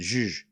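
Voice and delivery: Zh held by itself, voiced; isolated sound. Shown as a demonstration. Streamer showing viewers the middle sound, isolated sound. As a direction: demonstrate the Zh buzz on its own. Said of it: "like that".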